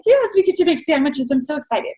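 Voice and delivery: thrilled little girl who can't wait, lightly played; excited child. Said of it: "She was all excited".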